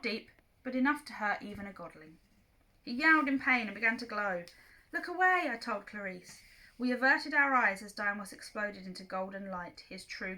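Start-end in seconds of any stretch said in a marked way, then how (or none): 4.9-5.5 high loud warning; urgent warning. Acted. Percy warns Clarice before the god explodes, high loud warning. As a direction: bark it fast to protect her.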